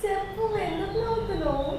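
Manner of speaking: exasperated questioning; insistent demand. Real, not acted. Pressing sister who keeps laughing.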